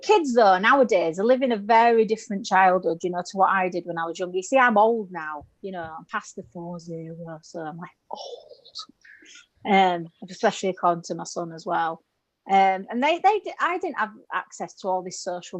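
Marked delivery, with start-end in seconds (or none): 5.8-9.7 slow breathy whisper; mock confession whisper. Comic telling audience she is old, slow whisper. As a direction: hush it out like an embarrassing secret. Aside about being over forty.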